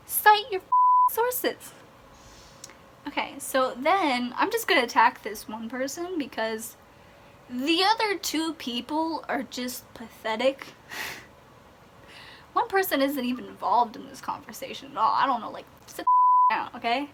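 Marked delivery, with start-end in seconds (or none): 0.1-1.7 high, loud, drawn-out exasperation; shrieking exasperation, slowed down; exasperated yell. Real, not acted. Ranting at online critics. 15.9-17.1 a high, loud burst that drops off; exasperated yell. Real, not acted. Telling critics to sit down.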